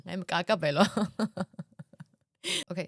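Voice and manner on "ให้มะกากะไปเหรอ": real laughter around the words; laughing. Real, not acted. Speaker repeating the funny line, laughing. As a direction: repeat it while cracking up.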